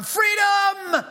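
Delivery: high, loud battle cry; shouting Freedom. Retold or imitated. Preacher quoting William Wallace, loud battle cry. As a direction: bellow it like a rebel leader. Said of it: "William Wallace shouting".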